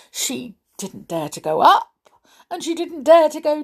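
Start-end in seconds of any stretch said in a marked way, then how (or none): none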